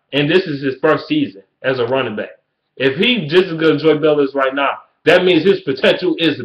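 normal talking voice

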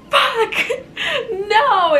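high, loud, half-laughing wail; mock dismayed cry. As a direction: re-enact the horror with a laugh. Acts out reaction getting out of car.

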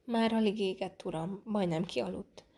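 ordinary delivery, nothing marked

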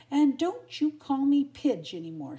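loud, high, angry warning; indignant storybook snap; snarled. A narrator's reading. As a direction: tell him off sharply. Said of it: "she snarled".

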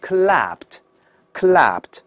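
slow "clapped" ending in t; "clapped", the -ed a separate light t release; voiceless t ending. Shown as a demonstration. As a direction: model "clapped" carefully as one syllable. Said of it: "怎么说？".

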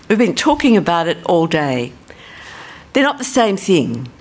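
usual speaking voice

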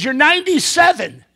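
high, loud acted greeting; forward elderly woman. Elderly woman approaching a gentleman, high loud greeting. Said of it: "She pulls it out and says".

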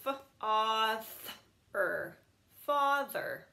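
F-a-th-er sounded, then father blended. Shown as a demonstration. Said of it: "we could figure this out".

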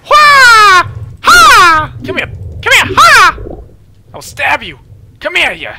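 very high, loud war whoop; battle cry. Pretend. Jumping into shark water, a wild high battle cry. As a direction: charge the sharks screaming.

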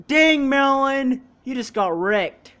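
loud, drawn-out crowing; excited trash talk; gloating exclamation. Real, not acted. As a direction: slowly rub it in at full volume. Crowing over a hard tackle.

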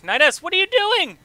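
high, loud, shocked yell; shocked shout. Real, not acted. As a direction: scream at the screen in disbelief. Character does something shocking.

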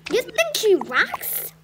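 high, loud, slow incredulous question; can't believe what you heard; shocked disbelief. Acted. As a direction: kid shocked that someone likes the principal.

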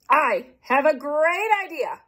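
slow, high eager exclamation; exclaiming. Acted. Kansas announcing his party idea, slow and excited. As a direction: announce the brainwave. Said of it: "exclaimed Kansas".

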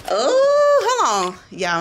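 usual speaking voice

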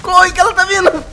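high, loud cries breaking into laughter; half-laughing panic as the chase starts; panicked yelling. Real, not acted. Player fleeing the creature that spotted them, panicked.

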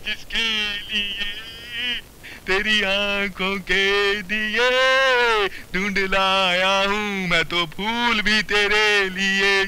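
slow, dreamy poem reading; romantic poem recital. Acted. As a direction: recite it like a lovesick poet.

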